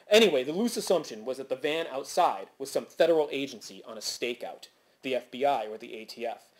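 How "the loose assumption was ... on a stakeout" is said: usual speaking voice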